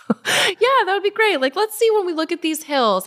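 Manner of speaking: high-pitched, tongue-in-cheek optimism; singsongy high self-talk, easing down toward the end; mock optimism. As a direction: pitch it way up, humouring yourself. Voicing her hopeful mindset about mile sixteen.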